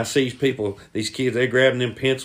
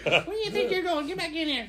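high, softer hollering voice; coach impression; 5 dB quieter than usual. Teller reprising the coach's window call, high-pitched.

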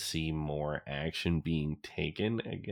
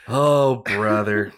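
loud, high, drawn-out exasperation; exasperated groan; 4 semitones higher, 11 dB louder, much slower than usual. Real, not acted. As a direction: groan it out slowly in disbelief. Exasperated reaction after the story.

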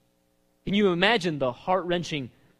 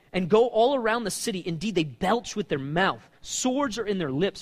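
preaching the psalm; 5 semitones higher, 6 dB louder than usual. Acted. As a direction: preach every phrase to the room. Preacher reads psalm at full force.

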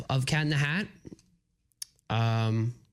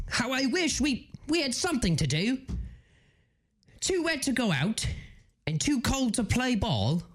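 put-on British accent; 8 semitones higher than usual. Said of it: "so continue British".

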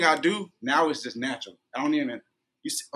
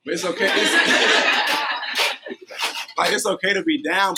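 higher and louder, laughing between words; 6 semitones higher, 7 dB louder than usual. Real, not acted. Telling listeners it's okay to cry, laughing as he says it. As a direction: giggle your way through it. Laughing through his point.

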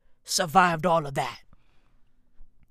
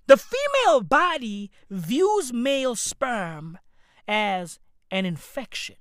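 ordinary talk, nothing marked

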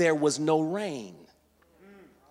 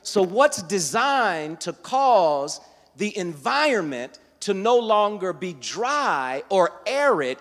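raised, louder preacher's voice; full-force preaching; 5 semitones higher, 6 dB louder than usual. Acted. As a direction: build to a loud, high-pitched preaching peak. Preaches to a responding congregation.

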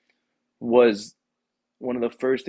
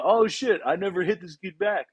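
surprised; 8 semitones higher than usual. Retold or imitated. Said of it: "you'd be like".

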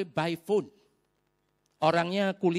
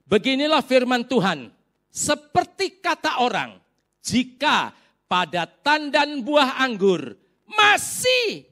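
slow, loud, measured proclamation; solemn scripture reading; 7 dB louder, slower than usual. Real, not acted. Preacher reading Isaiah to congregation, slow emphatic proclamation. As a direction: proclaim it with long pauses and ringing key words.

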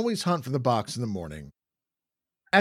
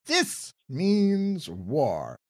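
dramatic declaration; 3 semitones higher, much slower than usual. Acted. Declaring war on werewolves.

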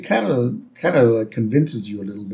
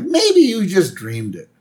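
lightly voiced child, higher pitch; Lisa; 7 semitones higher than usual. Lisa doubts her dad's alien tale, lightly raised voice. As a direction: offer it in a slightly raised cartoon-girl voice. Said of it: "Lisa's like".

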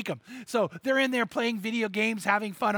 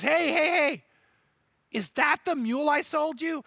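calling out; 7 semitones higher than usual. Retold or imitated. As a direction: call out to a friend across the field. Farmer calls out from car.

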